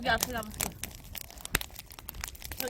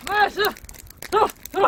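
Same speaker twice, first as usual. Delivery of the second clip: loud, high urging call; excited shout; 7 semitones higher, 5 dB louder than usual. Real, not acted. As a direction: shout it out eagerly. Urging during rock paper scissors.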